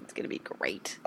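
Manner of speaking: eerie whispered tease; spooky whisper. Pretend. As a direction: whisper it like a ghost. Teasing spooky voice continued.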